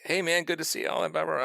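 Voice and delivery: high-pitched mock friendly greeting; bubbly. Player voicing the greeting he failed to give, bubbly. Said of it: "like, bubbly and whatnot".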